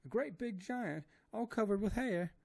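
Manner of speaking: high, loud wailing maid voice; terrified woman begging to die, high wailing; crying Esmeralda. A narrator's reading. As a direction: wail in despair with eyes shut. Esmeralda's voice held.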